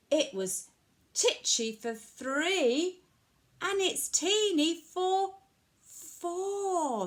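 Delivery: bouncy rhyming storytelling, stretched refrain; reading to children. A narrator's reading. Old lady begs wise man for help, animated storytelling. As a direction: punch out the rhyme with pauses between phrases.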